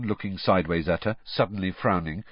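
usual speaking voice

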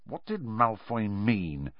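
soft, slow muttered question. A narrator's reading. Harry muttering to Ron at the basin, soft and slow. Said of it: "Harry muttered to Ron".

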